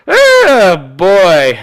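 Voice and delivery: exasperated. Real, not acted. Exasperated exclamation.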